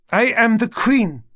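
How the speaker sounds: high, loud and slow regal demand; queen shouting through a closed door; indignant queen. Acted. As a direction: insist on your royal rank. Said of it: "came her familiar voice".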